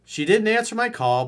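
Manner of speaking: ordinary talk, nothing marked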